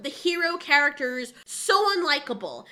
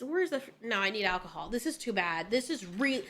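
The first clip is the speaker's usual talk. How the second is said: muttered despair; 7 semitones lower, 9 dB quieter than usual. Real, not acted. Despairing at the show.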